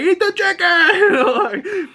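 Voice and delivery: very high, slow, put-on voice breaking into laughter; laughing demand. Driver insisting again, impression dissolving into laughter. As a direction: insist while cracking up. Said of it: "He's like".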